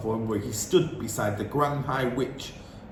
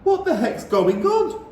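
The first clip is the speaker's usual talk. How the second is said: put-on high, loud boy's question; puzzled boy demanding answers; Bruno asking; 14 semitones higher, 5 dB louder than usual. A narrator's reading. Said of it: "Bruno asked".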